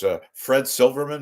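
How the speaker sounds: usual speaking voice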